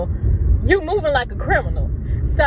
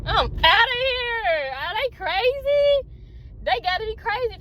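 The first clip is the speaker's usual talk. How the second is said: incredulous outburst; 11 semitones higher than usual. Real, not acted. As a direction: wail it in disbelief while getting away. Driving away from suspected kidnappers.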